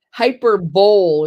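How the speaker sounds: hyperbole as "hyper-bowl"; mispronounced HYPERBOLE. Shown as a demonstration. Host showing her mistake, hyperbole as "hyper-bowl". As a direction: demonstrate hyperbole ending like bowl. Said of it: "is what I kept saying".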